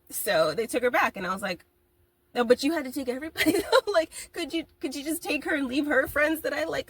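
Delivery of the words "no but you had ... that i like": mock pleading. Pleading with the universe, laughing.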